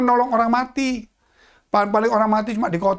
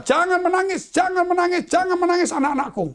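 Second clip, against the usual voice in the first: pleading repeat; 8 semitones higher than usual. Acted.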